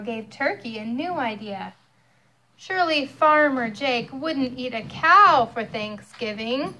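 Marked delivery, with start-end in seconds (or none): none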